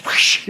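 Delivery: sudden rushing whoosh noise; imitated whoosh. Telling how the thing flew at them, imitated whoosh. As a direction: mimic something swooping past with a hissy rush.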